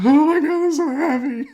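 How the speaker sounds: high, loud squeal voicing the dog; overwhelmed happiness. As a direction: burst out as a puppy overcome with joy. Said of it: "doesn't know how to handle her happiness".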